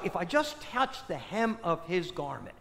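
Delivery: softly, as if thinking to herself; hushed private resolve, voiced softly; inner thought. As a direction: voice a quiet thought to oneself. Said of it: "she thinks to herself".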